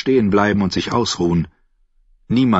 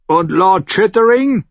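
higher and louder, hands cupped round the mouth; calling up; 11 semitones higher, 5 dB louder than usual. Acted. Davos calls up to the guard on the wall. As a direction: call up to the gate.

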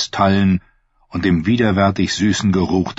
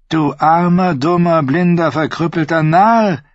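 Tyrion's spite, pitched high and louder; vicious hiss; 9 semitones higher, 3 dB louder than usual. Acted. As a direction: spit the insults out cruelly. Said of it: "hatte er bösartig gefaucht". (bösartig gefaucht, hoch und laut)

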